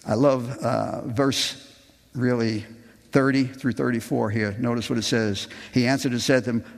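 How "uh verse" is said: normal talking voice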